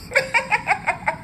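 sharp high laugh near to tears; high pained laugh. Acted. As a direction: laugh that tips toward crying. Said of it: "I laugh".

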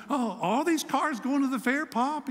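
excited little grandson. Retold or imitated. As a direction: voice the curious little boy asking his pop.

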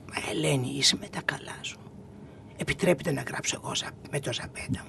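low, soft imitation of a scandalised refusal; hushed indignation. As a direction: half-whisper it as if appalled by the idea. Retold indignant refusal to write.

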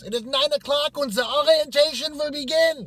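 high, loud barked announcement; strict orientation leader. Retold or imitated. Woman at the front opens orientation, barking shrilly.

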